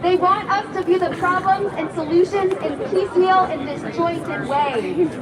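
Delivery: impassioned rally speech; fiery revolutionary speech. Acted. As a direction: rouse the crowd. Rally speech against enemies.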